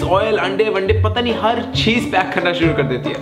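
usual speaking voice

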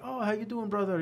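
high, lightly voiced sweet greeting; mock friendly Rami. Retelling Rami's warm hello at the airport, lightly imitated. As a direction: play the gentle giant greeting him kindly. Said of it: "He's like".

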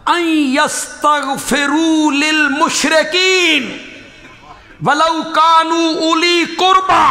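slow, drawn-out Arabic recitation, sung out; Quranic recitation. Acted. Preacher reciting the verse to his congregation, slow and drawn out. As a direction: intone the verse slowly.

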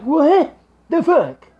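loud, high, drawn-out what the fuck; staring at the video, slow and stunned; disbelieving look. Retold or imitated. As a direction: stretch it out in sheer disbelief. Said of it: "that is me looking at you like".

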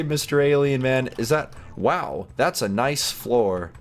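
usual speaking voice

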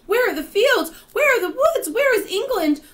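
very high, loud panicked questions; distressed cry. A narrator's reading. The travellers search for vanished land, distressed cry. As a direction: cry out frantically, each question pealing high. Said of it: "they cried".

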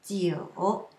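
jiu low, dipping then rising; sound demo. Shown as a demonstration. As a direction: stretch jiu through a low dip. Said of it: "a few sounds which are different".

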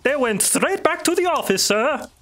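high-pitched underling reporting to a boss; Rahir. Acted. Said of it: "Rahir speaks up".

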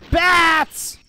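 high, loud drawn-out yell; startled shout. Real, not acted. Player suddenly spotting bats, startled shout. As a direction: yell the alarm.

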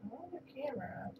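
soft whisper to the cat; quiet unvoiced whisper. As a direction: hush it conspiratorially.

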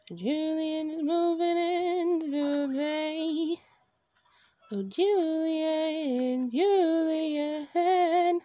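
real delighted drawn-out call; sing-song squeal. Real, not acted. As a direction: sing it out like a victory chant. Celebrating villager moving in.

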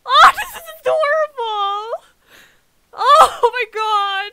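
loud high squeal, stretched out; overwhelmed delight. Real, not acted. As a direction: cry out, melting with delight. Reacting to streamer's delight at raid.

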